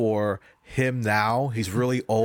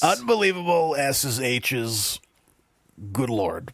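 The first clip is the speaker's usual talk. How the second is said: Ric Flair impression; 3 semitones higher, slower than usual. Co-host hyping the score as Ric Flair, mock wrestler. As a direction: hype it like a wrestling legend. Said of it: "I slipped Ric Flair into that".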